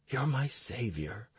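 soft, hushed near-whisper. A narrator's reading. Husband to his crying wife after calming himself, whispering. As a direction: drop the volume right down, hushed. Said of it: "I whispered".

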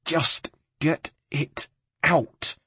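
slow, strained through clenched teeth; through gritted teeth. A narrator's reading. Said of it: "Rosa gritted her teeth".

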